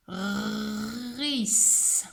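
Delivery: "Ris" with long r and long final s; sounding out. Shown as a demonstration. Teacher dictates three sounds to pupil, "Ris" stretched slowly. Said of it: "la deuxième".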